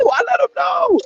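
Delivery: victory-lap yell; triumphant shout. Real, not acted. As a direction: shout it like you just won the argument. Boasting about shutting cousin up.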